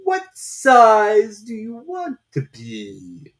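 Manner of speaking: very slow, loud, strange drawn-out voice; slow caterpillar drawl. A narrator's reading. Caterpillar lazily questioning Alice, very slow and loud. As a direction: stretch every word lazily, from on high.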